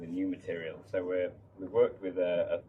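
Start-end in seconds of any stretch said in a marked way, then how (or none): none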